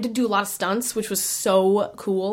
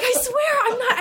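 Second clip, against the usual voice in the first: squealing denial; 13 semitones higher than usual. Retold or imitated. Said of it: "I'm like".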